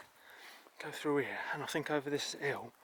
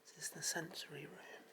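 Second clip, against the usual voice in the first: hushed whisper; 4 semitones higher, 18 dB quieter than usual. Real, not acted. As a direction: whisper it softly. Quiet room visit, hushed voice.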